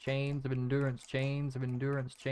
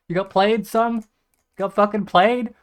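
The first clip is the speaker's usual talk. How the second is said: mocking taunt; 6 semitones higher, 11 dB louder than usual.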